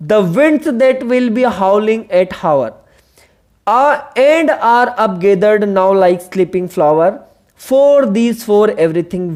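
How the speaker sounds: very slow, measured reading of the sonnet lines; teacher reading Wordsworth to the class; poem recitation. Shown as a demonstration. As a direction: recite the verse slowly with pauses.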